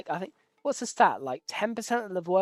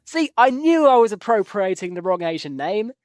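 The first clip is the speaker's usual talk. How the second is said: loud, high burst of recognition; excited realisation; 4 semitones higher, 10 dB louder than usual. Real, not acted. Gamer realising he mixed up two admirals, excited outburst. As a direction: exclaim it as the name clicks. Realises his earlier name mix-up.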